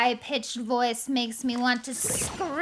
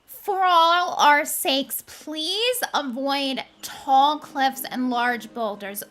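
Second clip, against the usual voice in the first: slow grand proclamation; 2 semitones higher, 4 dB louder, slower than usual. Acted. Queen addresses chat grandly.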